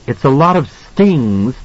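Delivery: irritated wife's comeback; angry snap. A narrator's reading. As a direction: snap back at your husband. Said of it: "she snapped at him angrily".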